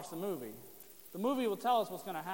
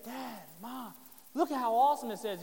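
put-on louder, eager child voice; excited kid; 3 semitones higher, 5 dB louder than usual. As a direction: show off a treasure like an excited kid. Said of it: "one of your kids came to you and said".

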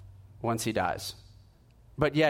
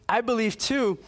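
much higher, with a laugh on too; a friend half laughing as he says it; imitating giddy amazement; laughing disbelief; 9 semitones higher than usual. Friend repeats claim, laughing.

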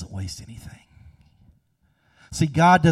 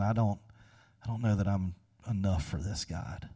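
much softer, fading into a hush on "God"; a lightly voiced, hushed confession; quiet prayer; 4 semitones lower, 7 dB quieter than usual. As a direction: pray it softly to the stars. Soldier praying alone at night.

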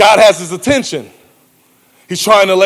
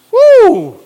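high excited whoop; preacher whoops at the thought; 12 semitones higher, 5 dB louder than usual. Real, not acted. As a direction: let out a joyful whoop. Preacher's exclamation.

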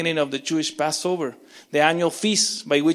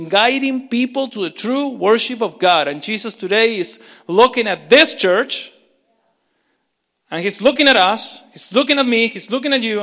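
higher and louder, building up; preaching intensely; 6 semitones higher, 7 dB louder than usual. Real, not acted. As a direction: raise the sermon to full force. Sermon climax.

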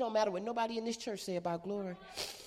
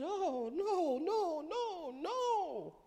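dismayed; 7 semitones higher, 5 dB quieter, much slower than usual. Retold or imitated. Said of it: "I said".

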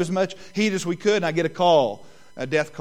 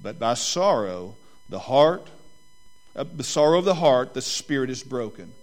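scripture reading; 4 semitones lower than usual. Acted. Pastor reading Proverbs to the congregation, measured. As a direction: read the verses with weight.

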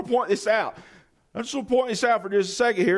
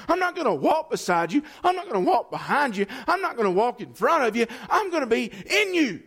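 higher, driving sermon; fervent preaching; 7 semitones higher than usual. Acted. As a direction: lift the congregation with fire. Preaching builds to full force.

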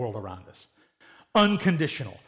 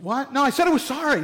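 put-on higher-pitched defensive kid; mock-indignant, pitched up; indignant protest; 8 semitones higher than usual. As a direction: protest like a child accused of a fake apology. Acted child protesting to a parent.

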